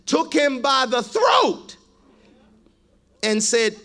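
loud, driving sermon reading of the parable; full-force preaching. Acted. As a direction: preach it out to the whole church. Preacher reads the parable aloud.

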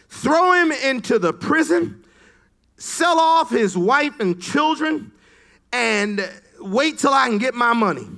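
high, loud royal order, barked at the servant; a king roaring his sentence; angry king's command. Acted.

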